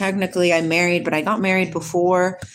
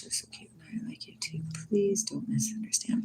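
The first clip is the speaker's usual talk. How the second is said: soft secret whisper; 13 dB quieter than usual. Real, not acted. Reassuring a viewer in a soft whisper. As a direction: whisper it like a confession.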